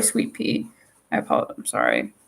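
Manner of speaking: softly whispered sorry to a viewer; whispered apology to Sweet Pea in chat. Real, not acted. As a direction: breathe the apology out, no voice.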